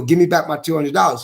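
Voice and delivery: ordinary talk, nothing marked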